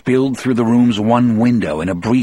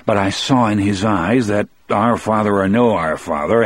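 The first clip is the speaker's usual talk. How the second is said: ordinary delivery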